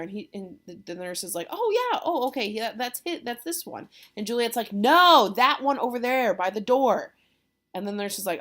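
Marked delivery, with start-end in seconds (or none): 1.5-3.9 very high "oh" settling down; exaggerated, imitated dawning recognition; mock realization. Nurse to Juliet, picking the wrong man, high mock realization. 4.7-7.1 loud, high mock impatience; hammed-up "no", then insistent pointing out; exasperated correction. Juliet correcting the nurse, loud mock exasperation.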